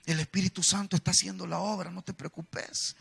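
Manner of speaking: softly soothing, slowing at the end; reassuring pastor. Retold or imitated. Pastor comforting a man struggling with music, softly reassuring. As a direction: imitate a pastor gently calming someone. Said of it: "el hermano Oscar me decía".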